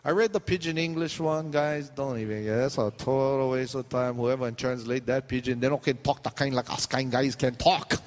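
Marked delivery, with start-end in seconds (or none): none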